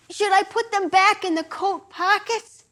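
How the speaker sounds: put-on high, timid boyish voice; naked boy asking the mother meekly. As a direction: ask meekly, embarrassed.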